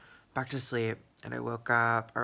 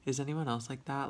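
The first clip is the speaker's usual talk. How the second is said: ordinary talk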